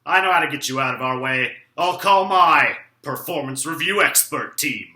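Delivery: normal talking voice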